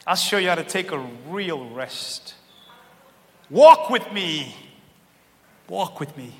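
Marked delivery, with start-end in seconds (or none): 3.5-4.5 slow, louder, drawn-out invitation; loud slow call. Shown as a demonstration. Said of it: "repeats the line differently". 5.7-6.3 softer, lower echo of the line; soft repeat. Shown as a demonstration. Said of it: "repeats the line differently".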